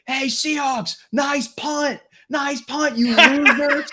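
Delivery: very high mock shout; imitated heckling yell. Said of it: "you're yelling at the".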